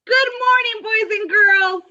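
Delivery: high, loud, bright assembly greeting; announcer. Acted. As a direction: greet the whole school, big and high. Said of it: "announcer greeting to school".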